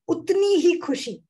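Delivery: lifted, emphatic swell on उतनी ही खुशी; exaggerated tone. Shown as a demonstration. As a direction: slightly overdo उतनी ही खुशी with feeling. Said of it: "slightly more exaggerated".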